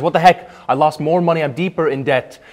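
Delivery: loud, high exasperated gambler; frustrated outburst. Acted. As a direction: yell it at the fish, fed up. Gambler angry at the fish.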